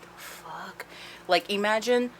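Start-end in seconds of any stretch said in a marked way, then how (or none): 0.0-0.8 barely voiced, stunned; hushed confused the fuck; breathy disbelief. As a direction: mutter it under your breath, baffled. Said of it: "And he's just like".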